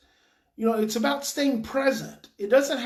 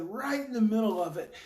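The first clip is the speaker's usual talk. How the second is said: ordinary talk, nothing marked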